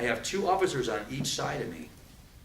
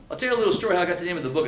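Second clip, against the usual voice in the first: normal talking voice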